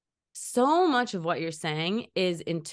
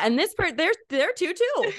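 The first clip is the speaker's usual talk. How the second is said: excited insistence; 10 semitones higher, 4 dB louder than usual. Retold or imitated. Arguing with husband Seth.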